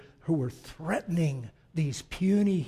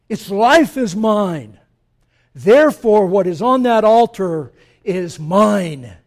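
God's voice, proclaiming; 4 semitones higher, 11 dB louder than usual. Acted. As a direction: thunder it out as God speaking to his people. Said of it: "he blows our minds and he says".

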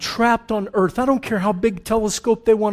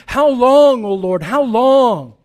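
louder, anguished plea to God; pleading cry; 2 semitones higher, 5 dB louder, slower than usual. Retold or imitated. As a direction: cry out as the martyred saints. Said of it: "They're crying out and they're asking".

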